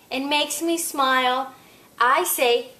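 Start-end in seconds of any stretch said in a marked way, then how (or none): none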